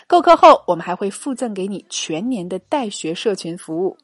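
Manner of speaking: loud, upbeat sales pitch; ad read. Acted. Host pitches the paid course to listeners, upbeat and loud. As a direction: sell the course with energy.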